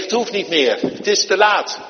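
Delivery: preacher voicing the father's messenger, loud; loud urgent news. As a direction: announce the bad news urgently.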